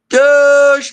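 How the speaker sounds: high, loud, drawn-out mock Guatemalan accent; calling out, Guatemalan accent. Guatemalan coworker calling out across the salad bar, mock accent. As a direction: sing it out loudly in an imitated Guatemalan accent. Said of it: "he had such a heavy accent".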